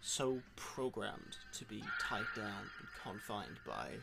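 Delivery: put-on American accent, lightly done; podcast host faking an American accent; fake American accent. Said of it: "That sounds so American".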